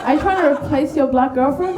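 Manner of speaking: ordinary delivery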